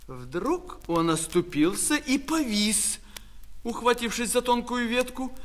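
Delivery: ordinary delivery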